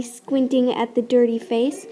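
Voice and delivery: ordinary delivery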